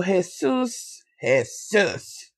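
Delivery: "Jesus" twice as a stretched "heh-SOOS"; playful name pronunciation. Pretend. Back to the story, playfully drawn-out "Jesus". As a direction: draw out "Jesus" the Spanish way, relishing each syllable. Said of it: "I gotta say it like that".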